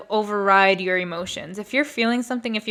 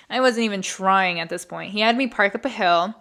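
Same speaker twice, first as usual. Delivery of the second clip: ordinary delivery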